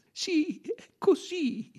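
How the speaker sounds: high, tearful, halting admission; crying. A narrator's reading. Nursemaid confessing to the detective, crying. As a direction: sob out the words, drawn out. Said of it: "pianse la donna".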